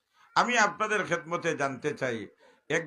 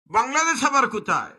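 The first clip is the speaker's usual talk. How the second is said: high, loud puzzled question; the stranger asks where Bangladesh is; loud preaching; 12 semitones higher, 9 dB louder than usual. Acted. (অবাক সুরে চড়া প্রশ্ন)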